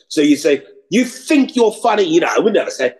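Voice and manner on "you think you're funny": "think" said as "fink", pitched high; Cockney "think" with an F for the TH. Shown as a demonstration. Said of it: "T H is pronounced with a F".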